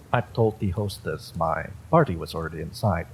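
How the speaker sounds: ordinary delivery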